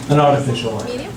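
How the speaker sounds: ordinary talk, nothing marked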